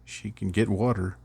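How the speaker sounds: ordinary talk, nothing marked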